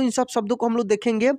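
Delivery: ordinary talk, nothing marked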